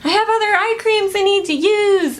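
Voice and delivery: high-pitched mock self-quote; quoting own thought. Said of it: "because I was like".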